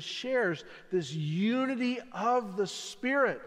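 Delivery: ordinary talk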